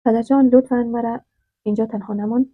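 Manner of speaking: usual speaking voice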